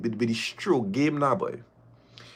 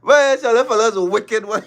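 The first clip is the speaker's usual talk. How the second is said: higher, loud laughing yell; laughing shout; 10 semitones higher, 5 dB louder than usual. Real, not acted. Caller laughing at Chris, shouting high.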